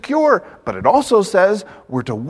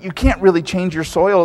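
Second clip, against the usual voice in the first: ordinary talk, nothing marked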